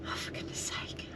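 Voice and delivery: very soft, whispered exasperation; real hushed exasperation; exasperated whisper. Real, not acted. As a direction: whisper it under your breath, fed up. Exasperated at delayed tow truck.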